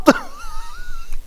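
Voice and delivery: high, wheezing, near-crying laugh; helpless laugh. Real, not acted. Laughing at the gullible reviewer, helpless high laugh. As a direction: laugh until it squeaks.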